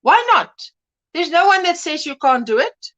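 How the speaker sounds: ordinary delivery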